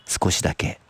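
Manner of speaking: low, unhurried narrator closing a tale; story narration. A narrator's reading. Narrator closing the story of Natsuko's retort, low measured narration. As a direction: read it low, pausing between phrases.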